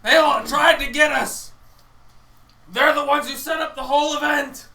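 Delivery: loud, slow declaring to the crowd; slow loud public denouncing; loud accusation. Acted. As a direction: proclaim it to the whole town. Player accuses crowd loudly.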